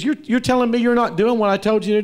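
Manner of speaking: high, fast, loud boss; angry manager. Retold or imitated. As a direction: fire off the boss's outraged question. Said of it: "he goes".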